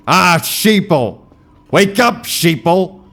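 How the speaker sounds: high, loud, slow preacher rant; conspiracy guy voice. Pretend. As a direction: shout it like a doomsday prophet. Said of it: "I'm not doing that bit".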